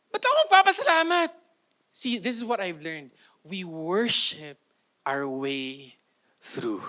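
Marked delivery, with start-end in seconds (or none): 0.1-1.3 high, fast mock whine; whiny complaint. Preacher mimicking an ungrateful believer, mock whine.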